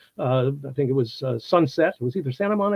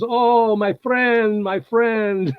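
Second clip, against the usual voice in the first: higher-pitched, gushing "my friend"; effusive Italian greeting; 7 semitones higher, much slower than usual. Retold or imitated. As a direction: ham up a big Italian bear-hug welcome. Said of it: "with that Italian effusive kind of".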